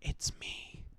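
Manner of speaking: very soft, voiceless imitated whisper. Nikki revealing herself to Bear in bed, whispering. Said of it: "Nikki whispers".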